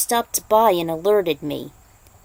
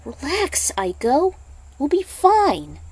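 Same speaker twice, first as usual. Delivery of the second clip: reassuring; 7 semitones higher than usual. Acted. Lao put a hand on her shoulder.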